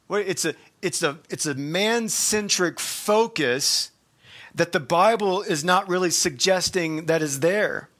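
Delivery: normal talking voice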